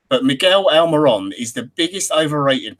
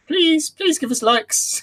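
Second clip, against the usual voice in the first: high, slow begging voice; pleading put-on voice; much slower than usual. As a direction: beg for likes in a whiny high voice. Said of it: "me and Josh going".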